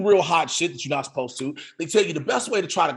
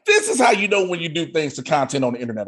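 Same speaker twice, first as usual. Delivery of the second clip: ordinary delivery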